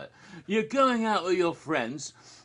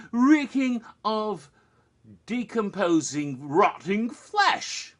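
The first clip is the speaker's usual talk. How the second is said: savoring the gross words; 3 semitones higher, 4 dB louder, much slower than usual. Acted. Describing a gruesome cellar.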